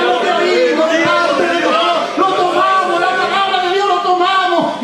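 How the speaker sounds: soaring revival preacher at full power; full-force preaching. Acted. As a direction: lift the congregation with a fiery preaching chant.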